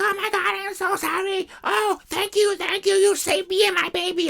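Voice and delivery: high, mock gushing thanks; a saved coworker gushes thanks in a high put-on voice; mock grateful coworker.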